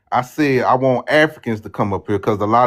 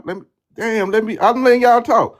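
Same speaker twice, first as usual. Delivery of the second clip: higher-pitched exasperated protest; 10 semitones higher, 5 dB louder than usual. Real, not acted. Host to interrupting panel, exasperated high-pitched protest. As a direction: plead to get a word in over the interruptions.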